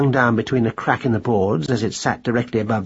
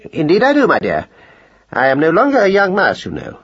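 higher, louder, falling away at the end; bravely persisting; 6 semitones higher, 5 dB louder than usual. A narrator's reading. Mouse husband answers his annoyed wife, bravely persisting. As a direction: stand your ground with a pompous flourish. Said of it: "he persisted bravely".

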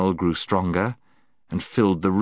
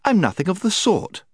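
indignant; 13 semitones higher, 3 dB louder than usual. Acted. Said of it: "stamping her foot".

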